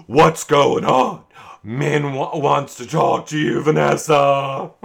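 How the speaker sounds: loud, stretched mocking imitation; stupid Kyle voice. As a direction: holler like a nosy doofus making sure everyone hears. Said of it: "yelling it in his stupid Kyle voice".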